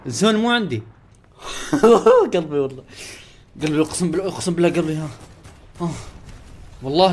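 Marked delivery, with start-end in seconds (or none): 1.4-2.3 quick high laugh burst; short laugh. Real, not acted. Gamer laughing at his friends, quick laugh. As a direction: let a laugh pop out.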